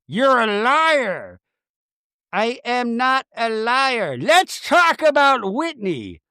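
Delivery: slow, high, drawn-out diva protest; mock quarrel. Castmates trading liar accusations, mock-outraged. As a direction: imitate castmates bickering in a hammed-up high voice.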